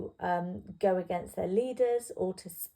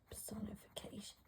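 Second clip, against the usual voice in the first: whisper; 20 dB quieter than usual. Real, not acted. Teacher murmuring the term to herself mid-lesson, whispered. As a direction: whisper "personification" softly to yourself, no voice.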